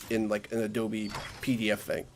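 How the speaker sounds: usual speaking voice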